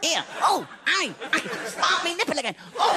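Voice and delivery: high, hammed-up cries of pleasure; exaggerated comic bedroom performance; mock passionate cries. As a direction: cry out high in fake ecstasy. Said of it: "I'm doing much going".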